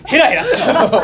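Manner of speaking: wrestling promo. Acted. Replaying the wrestling mic challenge.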